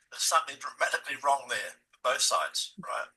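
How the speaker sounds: ordinary delivery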